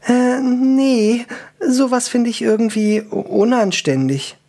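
high, hesitant elf voice; prim elf. Acted. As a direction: answer high and a little awkward. Elf answers the dwarf, embarrassed.

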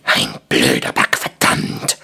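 very low, rough curse; deep, hoarse dwarf swearing; cursing dwarf. A narrator's reading. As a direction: growl the oath as a dwarf. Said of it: "fluchte Stramm".

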